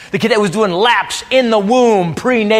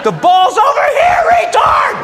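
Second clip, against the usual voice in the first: put-on high furious sideline scream at the kids; yelling dad; 3 dB louder than usual. Soccer dad screaming at his kid's teammates from the sideline, high shout. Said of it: "I'm not the dad to have at that game".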